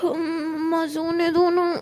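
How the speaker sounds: put-on half-asleep girl whining at who she thinks is mom; sleepy whine. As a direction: wail to be left asleep. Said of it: "रोज़ बोलती".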